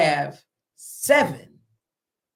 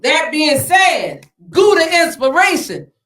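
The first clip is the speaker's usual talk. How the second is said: louder, slow hype-man call; hype shout; 6 semitones higher, 7 dB louder, slower than usual. Real, not acted. Host introducing the rapper to the crowd, loud and slow. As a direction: announce him like a hype man. Hyping up the guest.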